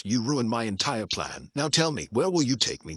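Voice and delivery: plain reading voice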